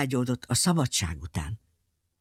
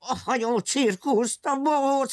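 angry villain; 8 semitones higher than usual. Acted. Villain forbids the ship circus.

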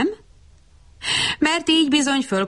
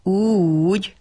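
usual speaking voice

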